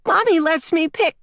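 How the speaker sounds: put-on high-pitched childish complaint; protesting little girl. A narrator's reading. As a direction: argue with Daddy like a preschooler. Small girl argues over clothes.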